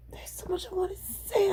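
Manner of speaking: unvoiced whisper, holding back. Real, not acted. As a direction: whisper it so no one hears. Whispering venting alone.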